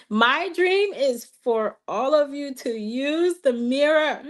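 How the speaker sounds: laugh-cry as she speaks; laughing, choked up. Real, not acted. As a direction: overcome with a happy laugh. Sharing her dream, emotional.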